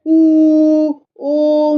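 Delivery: "ऊ" held long and loud, then a long "ओ"; rounded long vowels. Shown as a demonstration. Mother shows the mouth shape, long rounded vowels. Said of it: "मुंह किस बना".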